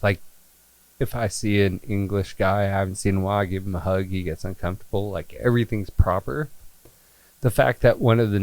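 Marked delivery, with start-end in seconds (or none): none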